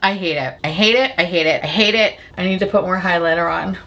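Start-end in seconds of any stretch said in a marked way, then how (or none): none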